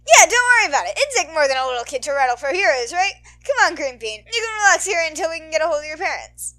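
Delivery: big, loud, cheery hero voice; loud radio host. Acted. Mike cheering up the lost kid, loud. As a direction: boom out the reassurance.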